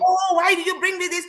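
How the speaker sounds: lightly acted petulant complaint; complaining. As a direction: whine it like a fed-up pregnant wife. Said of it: "I will make all kind of complaint".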